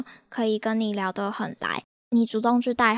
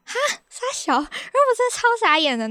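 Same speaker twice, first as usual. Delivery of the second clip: stunned disbelief; 12 semitones higher than usual. Real, not acted. Reacting to his love proposal, stunned and high. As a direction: squeal out how baffled you are.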